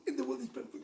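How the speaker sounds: ordinary delivery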